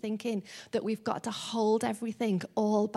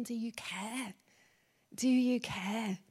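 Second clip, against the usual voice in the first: barely voiced, hushed asking; whisper; 4 dB quieter, slower than usual. Worshipper hardly daring to ask God, whispered. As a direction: breathe the question, hardly daring. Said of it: "we hardly dare to whisper it".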